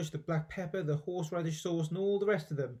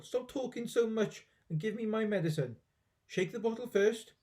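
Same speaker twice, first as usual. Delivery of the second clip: high-voiced cranky granny giving orders; grandma ordering George about her medicine, high and bossy; 6 semitones higher than usual. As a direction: boss the boy around.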